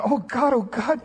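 fretting while the house burns; retold worried groaning. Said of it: "I'm all concerned".

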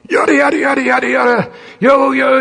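high, loud yelling nonsense; yelling stranger. Retold or imitated. Boy yelling at the sheep, high and loud. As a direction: shout to grab the sheep's attention. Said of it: "that stranger was yelling really, really loud".

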